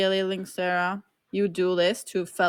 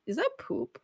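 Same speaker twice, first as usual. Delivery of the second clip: disgusted surprise; 6 dB quieter than usual. Real, not acted. Hit with something.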